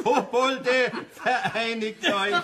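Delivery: high, slow mock proclamation through laughter; laughing mock battle cry; mock rallying cry. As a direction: call it out like a laughing slogan. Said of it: "Psst, nicht so laut".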